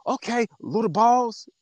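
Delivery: slow, high, jeering put-down; a slow mock taunt. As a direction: sneer it like a bully belittling someone. Mocking a man's put-down.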